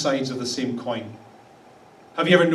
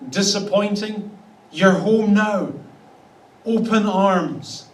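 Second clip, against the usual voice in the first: delighted welcome; 4 semitones higher, 3 dB louder than usual. Pretend. God voiced welcoming warmly.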